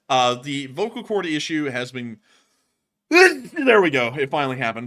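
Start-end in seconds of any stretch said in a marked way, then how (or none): 3.1-4.9 laughing. Real, not acted. Laughing after a sneeze.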